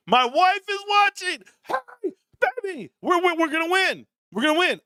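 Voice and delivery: high-pitched playful outburst to the wife; mock alarm. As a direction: call out in hammed-up panic. Caught swearing, calls out to wife.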